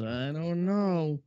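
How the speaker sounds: slow, stretched I don't know; drawn-out doubt. Retold or imitated. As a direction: let the doubt hang. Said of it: "He goes".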